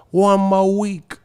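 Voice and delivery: put-on high, drawn-out British accent; mock British fan. British fan answers about the announcement, mock accent. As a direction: stretch out the British parody. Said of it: "the British teeth be like".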